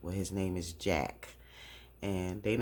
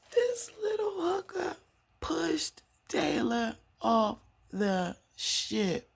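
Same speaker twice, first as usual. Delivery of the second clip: squeaky high, word by word; stunned disbelief; slower than usual. Real, not acted. Recapper learning she pushed Taylor, stunned disbelief. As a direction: squeal it slowly, spacing each word.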